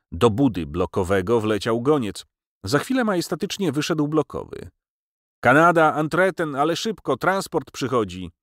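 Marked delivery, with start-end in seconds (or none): 5.4-8.3 high, raised messenger's call; urgent announcement. Acted. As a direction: call out urgent news to the barracks. Messenger rushes in with orders.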